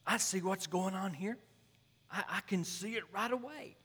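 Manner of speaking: high, loud, sure of himself; cocky young pastor. Retold or imitated. Young pastor boasting to his mentor, high and loud. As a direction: play the brash young preacher who has it all figured out. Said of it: "and he goes".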